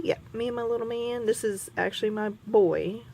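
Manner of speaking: ordinary delivery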